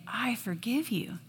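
softly whispered forgiveness. Retold or imitated. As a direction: breathe it out gently. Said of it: "he allows me to say".

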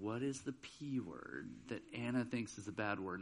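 low, very soft thinking to oneself; quiet, low inner question; hushed puzzling. Retold or imitated. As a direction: voice a teacher's private wondering. Teacher privately puzzling over word.

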